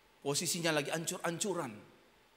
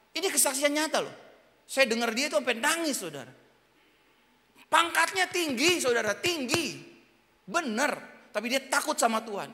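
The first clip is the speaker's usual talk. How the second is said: loud, higher insistent preaching; passionate preaching; 9 semitones higher, 8 dB louder than usual. Acted. Preacher to congregation, loud, raised passionate preaching. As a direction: press the point home to the crowd.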